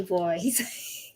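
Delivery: normal talking voice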